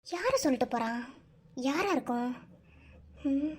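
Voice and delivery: low, soft puzzled musing; muttering to oneself. Acted. Wife left alone wondering who the girlfriend is, muttering. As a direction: wonder quietly under the breath.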